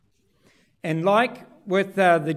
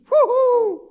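excited whoop; 7 dB louder than usual. Retold or imitated. Father cheering about coming home.

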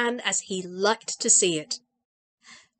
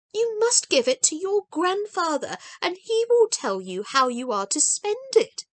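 a touch higher, kind but firm; lightly voiced gentle grandmother; 6 semitones higher than usual. A narrator's reading.

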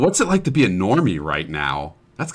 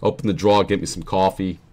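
demonstrating a New Jersey accent; faster than usual. Shown as a demonstration. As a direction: lean on the Jersey vowels in drawer and coffee. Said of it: "how good is my Jersey accent".

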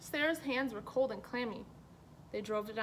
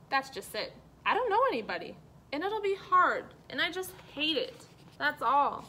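whiny complaint; 5 semitones higher, 5 dB louder than usual. Acted. Sarah complains she knows nobody.

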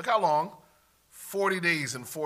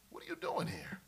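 low and very soft; intimate, lowered voice; hushed probing question; 9 semitones lower, 11 dB quieter than usual. Acted. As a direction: drop to a quiet, searching question. God's question turned softly to congregation.

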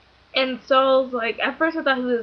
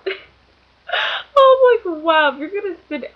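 loud, high laughing outburst; real laughter pushing the voice up; cracking up; 8 semitones higher, 7 dB louder, much slower than usual. Real, not acted. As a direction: burst out laughing into the words. Cracking up retelling picture message.

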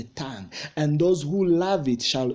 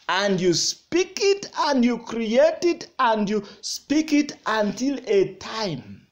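high, insistent pulpit voice; fervent preaching; 5 semitones higher than usual. Real, not acted. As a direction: build the phrases with driving repetition. Preaching at full force.

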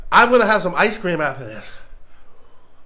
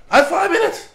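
pained laugh; 10 semitones higher than usual. Real, not acted. Laughing at the pain.

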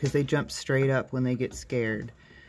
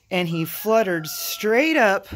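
normal talking voice

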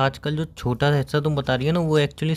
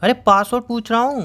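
higher, louder, fed-up protest; exasperated outburst; 8 semitones higher, 4 dB louder than usual. Acted. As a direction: boyfriend snapping at girlfriend for dodging the password, exasperated. Boyfriend losing patience over password.

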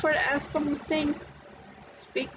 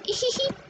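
high mocking giggle; 9 semitones higher than usual. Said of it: "That was a lie, of course".

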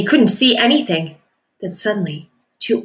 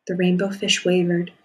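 ordinary delivery

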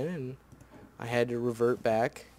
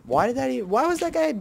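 higher, louder exasperated outcry; exasperated rant; 11 semitones higher, 5 dB louder, faster than usual. Real, not acted. Gamer complaining about being spawn trapped, exasperated and higher. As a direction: vent the frustration. Frustrated at mob attacks.

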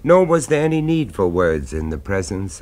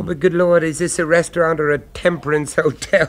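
high, loud, scandalised disbelief; shocked outburst; 10 semitones higher, 4 dB louder than usual. Acted. Diner to his friend about the dry restaurant, scandalised and loud. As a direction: exclaim it as if the idea is absurd.

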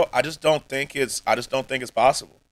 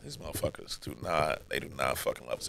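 dropped low and much softer; muttered cynical aside; 10 semitones lower, 16 dB quieter than usual. Retold or imitated. Mocking creators to chat, low sour muttered aside. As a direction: mutter it under your breath, sceptical. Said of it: "I'd be like".

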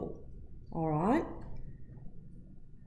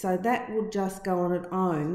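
normal talking voice

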